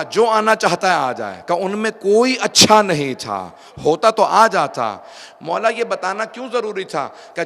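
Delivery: loud, high, emphatic sermon delivery; fervent preacher at full voice; full-force preaching. Acted. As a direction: drive each point home to the congregation.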